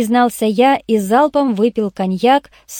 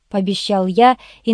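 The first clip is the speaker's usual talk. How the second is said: usual speaking voice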